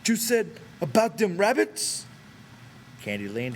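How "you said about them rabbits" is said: put-on high, slow, childlike big man; Lennie's eager voice. A narrator's reading. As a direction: blurt it out like an eager overgrown child. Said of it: "Lenny broke in".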